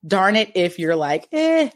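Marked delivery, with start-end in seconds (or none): 1.3-1.7 higher, drawn-out dismissive mimicry; mock indifference. Wife mimicking husband turning down a cookie, mock indifferent. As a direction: imitate someone slowly waving the offer away.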